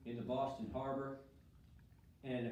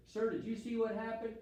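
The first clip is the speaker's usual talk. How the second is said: louder, higher questioning soldier; British soldier asking; 10 semitones higher, 5 dB louder than usual. Acted. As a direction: press the witness for an answer, pitched up. Teacher acts soldier asking Maddox.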